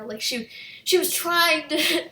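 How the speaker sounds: ordinary talk, nothing marked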